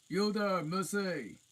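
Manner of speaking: high, loud giant shout; giant calling out. A narrator's reading. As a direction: call down to her like a giant. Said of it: "said the giant".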